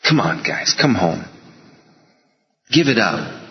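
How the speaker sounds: low, gentle voice of a patient God; patient pleading. Retold or imitated. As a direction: coax them home, quietly and low. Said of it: "He was so patient".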